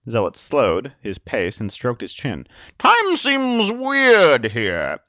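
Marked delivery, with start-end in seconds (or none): 2.8-5.0 very slow, high, portentous musing; grand, drawn-out bewilderment. Acted. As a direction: stretch each word in wonder. Zealot muses on strange time.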